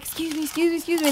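small, high voice asking to get by; retold polite squeezing past. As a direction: imitate politely edging past people. Retells squeezing past seated people.

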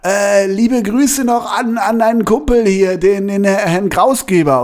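high, slow, fawning fan voice; a stranger fan passing on greetings, voiced high and slow. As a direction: play a gushing fan calling out after the show. Fan greets him after the show.

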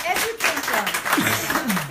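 educate them, stretched out slow and loud; slow emphatic push. Real, not acted. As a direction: drive the point home slowly. Urging listeners to educate doctors.

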